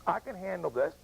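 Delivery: trying to hold it together while crying. Real, not acted. Veteran insists he's fine while crying at the wall. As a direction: voice cracking through tears.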